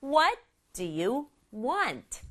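slow, word by word, "What" and "want" standing out; stress on WHAT and WANT. Shown as a demonstration. Teacher models mother's question, stressing "What" and "want". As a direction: punch "What", then land on a falling "want". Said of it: "원하니가 중요해요".

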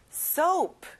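"soap" with a full long o vowel; clear long o. Shown as a demonstration. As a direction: model "soap" with the o glide, not "sop". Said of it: "가운데 O 발음을 내보세요".

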